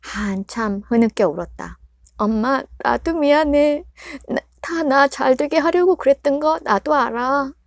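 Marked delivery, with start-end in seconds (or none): 2.2-7.5 tearful daughter. Acted. Daughter apologizes after sobbing.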